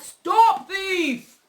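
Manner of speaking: high, loud storybook shout; calling out. A narrator's reading. Mister McGregor chasing Peter with a rake, calling out loudly. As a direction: yell it after a runaway thief. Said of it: "calling out".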